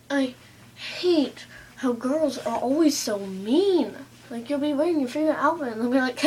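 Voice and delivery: ordinary talk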